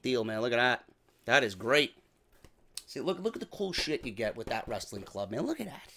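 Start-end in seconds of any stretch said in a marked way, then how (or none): none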